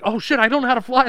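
high, loud panicked cry; mock panic. Voicing Beast crashing the jet, high loud panic. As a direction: panic mid-flight.

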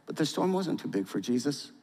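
ordinary talk

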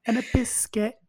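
put-on high, prim British lady accent; British lady impression. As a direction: play it as a proper English lady. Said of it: "I'm going to imitate her".

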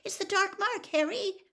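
high-pitched terrified moan; frightened moan. A narrator's reading. Hermione warns Harry as she pulls him, frightened moan. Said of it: "Hermione moaned".

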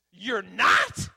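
very loud, high retort; dead-serious challenge. Retold or imitated. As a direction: imitate a mentor's sudden forceful shout. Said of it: "looks at me dead serious and says".

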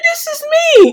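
high, loud shocked realization; loud exclaim. Retold or imitated. To herself at the training, a loud high exclaim. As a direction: shout out the discovery.

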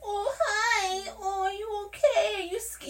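high, small, tearful mock voice; worried girlfriend. Girlfriend on a prison call, imitated tearful worry. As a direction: imitate a soft, whimpering worry.

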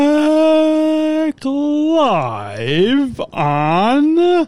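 long, stretched-out show intro; announcer voice. Acted. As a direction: draw it out like a host announcing the show.

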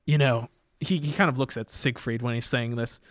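ordinary delivery

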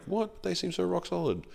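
high, stunned "What?" then dismay; shocked disbelief. Retold or imitated. Guest reacting to news of a celebrity breakup, stunned and high. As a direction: react to hearing a solid couple split, high and stunned. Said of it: "you're like".

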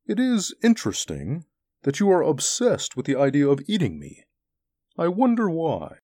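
musing creature with swinging pitch; wub, curious and unruffled. A narrator's reading. Wub to the men obsessed with eating it, mildly curious. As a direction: wonder aloud, politely and calmly.

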